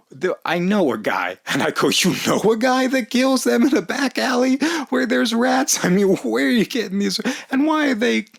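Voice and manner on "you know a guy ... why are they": high, far-voiced incredulous questions; hammed-up retold disbelief.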